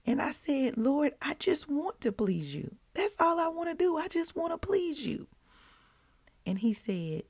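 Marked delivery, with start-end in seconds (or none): 0.8-5.3 high, tearful plea to the Lord; emotional prayer. Retold or imitated. Retelling her prayer, high and tearful with emotion. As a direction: pour out the longing to please him, choking up. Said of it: "got so emotional".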